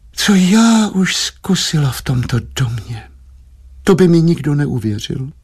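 high, plaintive wifely sigh. A narrator's reading. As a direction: sigh out a long-suffering complaint. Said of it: "vzdychla si paní Štědrá".

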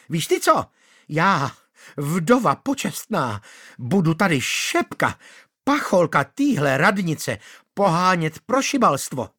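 high indignant widow voice; shrill angry widow. Acted. Manda telling Václav her plans, shrilly. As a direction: scold him shrilly.

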